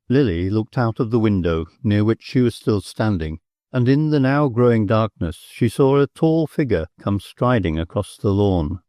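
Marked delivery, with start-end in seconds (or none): none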